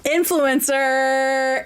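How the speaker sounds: протяжно, медленно тянет «Influencer», как пародийный инфлюенсер; растягивает слово с наигранным пафосом; mock influencer. Mocks influencer saying j'adore.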